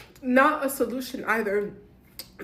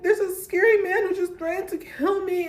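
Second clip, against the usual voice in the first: retold scream; 7 semitones higher, 4 dB louder than usual. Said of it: "like, screaming and I'm like".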